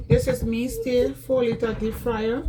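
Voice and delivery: normal talking voice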